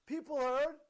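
ordinary talk

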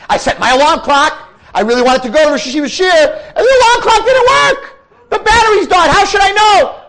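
a panicked rant of excuses, half crying, half laughing; frantic excuses. Acted. Guf's excuses building to a shout.

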